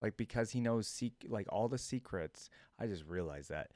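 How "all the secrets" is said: normal talking voice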